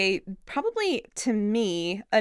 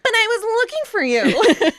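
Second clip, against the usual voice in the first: put-on much higher, louder breathy teen plea; overacted line; 13 semitones higher, 7 dB louder than usual. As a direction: push the line high like a Disney heroine. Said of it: "She's like".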